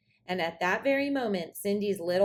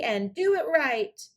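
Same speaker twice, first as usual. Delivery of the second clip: cried out; 7 semitones higher, 4 dB louder than usual. Acted. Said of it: "cried Cindy".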